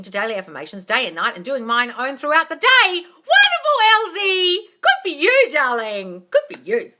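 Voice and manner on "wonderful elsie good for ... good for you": very high, loud shout of praise; delighted shout; cheering. Real, not acted. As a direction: cheer her on. Cheering a viewer's progress.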